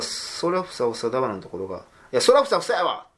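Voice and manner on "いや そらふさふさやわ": punchy Kansai-dialect 「いや、そらふさふさやわ！」, higher and louder; Kansai retort. Shown as a demonstration. Guest demonstrating the Kansai version of the line, a sharp Kansai retort. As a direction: snap back 「いや、そらふさふさやわ！」 in Kansai dialect like a tsukkomi. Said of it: "っていう風になるわけですよ".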